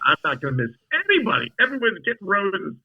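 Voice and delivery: mock-determined declaration rising in pitch; retold determination. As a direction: act out stubborn resolve. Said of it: "I was, like, determined".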